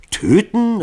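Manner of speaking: Töten? hoch und laut aufgeregt gerufen; erregt ausgerufen. Acted. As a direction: schrill empört das Wort Töten? rufen. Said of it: "Rief Legouville erregt". (aufgeregt rufend)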